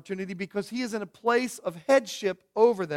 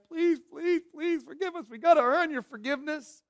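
high, pitiful begging voice; pleading brothers; 6 semitones higher, 3 dB quieter than usual. Acted. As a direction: beg for forgiveness in a high plaintive pitch. Voices brothers begging Joseph for forgiveness.

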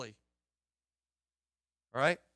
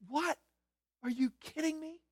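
incredulous; 9 semitones higher, 6 dB quieter than usual. Real, not acted. Incredulous exclamation.

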